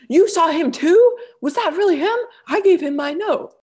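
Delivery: high, loud, thrilled outburst; retold fangirl excitement. Fan replaying talk with girl on the street, thrilled. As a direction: squeal it to a fellow fan.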